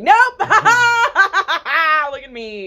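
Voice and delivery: high, loud, drawn-out showing off; hammed-up playful boast; gleeful taunt. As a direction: crow it while laughing, stretched out slowly. Acts out gleeful cartwheeling escape.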